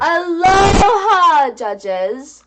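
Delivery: slow, loud sing-song hello; drawn-out loud greeting. Acted. Reading the listener letter aloud.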